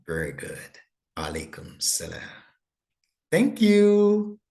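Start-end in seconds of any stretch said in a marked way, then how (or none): 3.3-4.4 high, loud, held-out thanks; big drawn-out closing flourish; singsong sign-off. Real, not acted. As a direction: sing out the farewell. Teacher's flourish at lesson's end.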